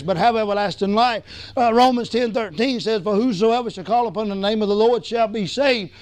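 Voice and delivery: ordinary talk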